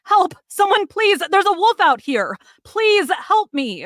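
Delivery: frantic shouts for help, pitched high; desperate yelling. Acted. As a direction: yell for help through the door. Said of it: "start pounding and yelling".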